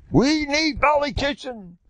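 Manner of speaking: high mock scream, slowed; crying out for him in a high voice; retold scream. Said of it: "he's screaming".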